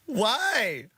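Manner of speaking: high, drawn-out disbelief; exasperated complaint. Real, not acted. Griping about accented characters in shows, exasperated.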